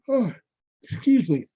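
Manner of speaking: words stretched by a yawn. Real, not acted. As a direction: yawn right through it. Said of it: "Sorry to yawn your ear".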